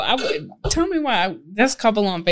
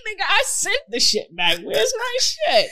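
higher and higher, mock furious; mock outrage; 10 semitones higher than usual. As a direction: voice climbing high in mock outrage. Angry about refund.

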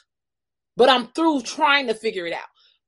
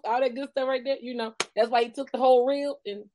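ordinary talk

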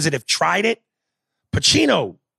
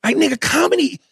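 excited rant; 12 semitones higher than usual. Real, not acted. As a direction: preach how hard comedy is. Ranting about comedy.

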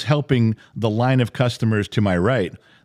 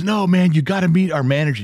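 a touch of an eager clerk, higher and quick; eager insisting; 8 semitones higher, 4 dB louder than usual. Retold or imitated. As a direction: urge it excitedly. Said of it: "he goes".

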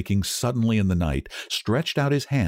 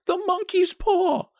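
slow, very high wild cry. A narrator's reading. Wife wakes husband about the monkey's paw, wild cry. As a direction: wail it out in frantic realisation. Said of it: "she cried wildly".

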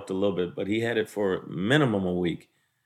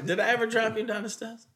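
mock surprised; 12 semitones higher, 4 dB quieter than usual. Real, not acted. Mock-offended question.

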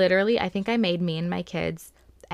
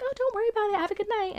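much higher, sweet and eager; sweet lady voice; much faster than usual. Retold or imitated. Delivery driver reassuring the customer, in a high sweet voice. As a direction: play the kindest delivery driver. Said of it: "And she's like".

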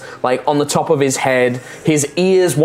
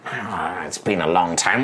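quiet lower wistful sigh; wistful goblin; 8 semitones lower, 7 dB quieter than usual. Acted. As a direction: drift into sad memory.

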